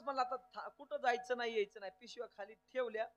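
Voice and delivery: usual speaking voice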